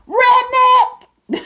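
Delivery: very high, loud parting shout; taunting shout out the car window; shouted insult. Retold or imitated. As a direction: yell it out the window while driving off. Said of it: "And I drove off and I said".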